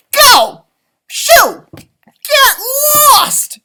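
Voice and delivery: very high, very loud furious shout. A narrator's reading. Leprechaun shouting the dog off his gold. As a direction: blast the dog away with each word. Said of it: "I shouted".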